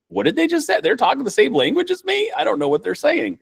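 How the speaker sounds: put-on high, bewildered voice of someone lost in a foreign accent; baffled listener. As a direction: squeak it out, totally confused by what you just heard. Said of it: "It's like".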